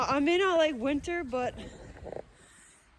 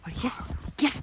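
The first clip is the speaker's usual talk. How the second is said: ordinary delivery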